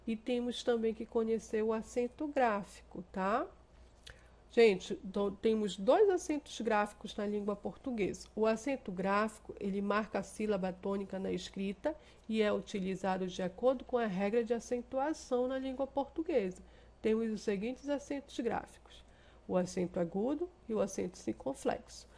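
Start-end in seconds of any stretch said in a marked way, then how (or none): none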